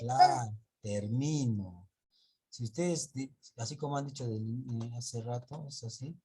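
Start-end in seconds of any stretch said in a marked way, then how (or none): 0.8-1.9 "termino" slow, with MI drawn out; slow "termino", leaning on MI; stress on MI. Shown as a demonstration. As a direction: stretch the middle syllable of "termino". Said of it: "Acá es termino".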